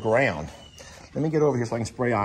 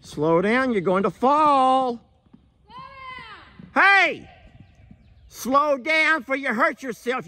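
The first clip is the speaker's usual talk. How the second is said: very high, urgent call across a distance; shouted warning; 13 semitones higher than usual. Real, not acted. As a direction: yell out a warning, stretching the words. Shouts warning to someone running.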